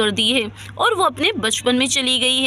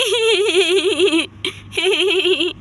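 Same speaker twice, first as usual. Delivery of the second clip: drawn-out, wavering kiddie laugh; childish giggle; 4 semitones higher than usual. Acted. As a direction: laugh teasingly like a small child. (बच्चों जैसी खिलखिलाहट)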